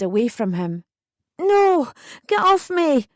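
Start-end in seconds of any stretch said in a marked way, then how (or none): 1.4-3.1 high, loud, shocked shout; upset, high-pitched yell; struggling shout. A narrator's reading. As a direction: shout it while yanking free. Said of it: "I shouted as I struggled".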